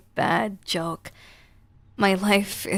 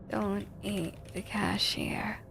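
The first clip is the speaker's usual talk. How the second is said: slow, soft whisper; 11 dB quieter, slower than usual. Retold or imitated. Player whispers the game's plea, slow and soft. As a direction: hush it as a plea. Quoting game line softly.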